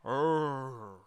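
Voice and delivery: drawn-out "rrrr" growl; rolled mimicked growl. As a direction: imitate a hype man's rolled growl. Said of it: "he came in, he".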